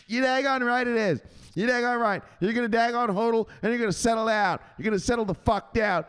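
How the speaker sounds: high-pitched bossy lecture; Richetta. Acted. Enforcer laying down the law to a trader, high scolding. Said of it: "Mister Richetta".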